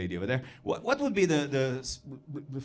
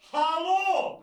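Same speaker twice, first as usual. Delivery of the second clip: high, drawn-out hello for everyone to hear; showy entrance call. Acted. Foreigner entering so everybody can hear, showy high call. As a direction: announce yourself in a high-pitched call. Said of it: "I would enter premises like this in this manner".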